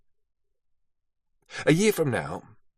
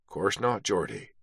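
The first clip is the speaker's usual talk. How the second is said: knowing quiet reply; 2 semitones lower, 7 dB quieter than usual. A narrator's reading. Beattie knowing reply, dropping voice.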